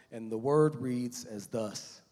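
usual speaking voice

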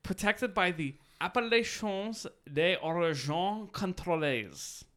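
careful French "Appellations des Origines Contrôlées"; French pronunciation. Shown as a demonstration. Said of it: "not gonna pronounce this Frenchly".

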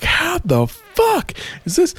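mock disbelief. Thor failing at the drinking challenge, mock high-pitched disbelief. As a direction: squeak it out in comic disbelief.

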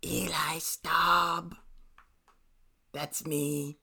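slow whispered name; subtle whisper. Pretend. Co-host introducing himself to listeners, subtle whisper. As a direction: whisper it slowly, drawn out. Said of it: "I can only speak in subtle whispers".

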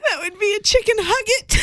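high, cracking up mid-sentence; laughing punchline. Real, not acted. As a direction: push it out through laughter.

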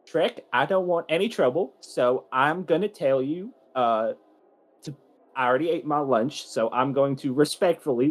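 forced polite calm. Acted. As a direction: keep your cool, barely. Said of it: "So he says".

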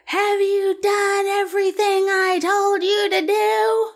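high, slow imitation of a stern older clerk, not really yelled; mock stern yell. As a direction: mimic the scolding clerk without full volume. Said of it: "I won't yell it the way she did, but she yelled it".

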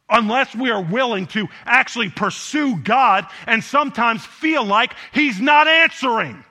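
usual speaking voice

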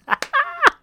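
squealing laugh; high laugh. Real, not acted. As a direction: giggle high. Laughing at joke.

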